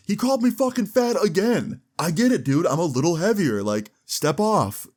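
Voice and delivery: hammed-up wounded pride, slowing at the end; mock offended friend.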